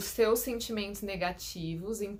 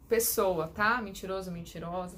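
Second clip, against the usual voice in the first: normal talking voice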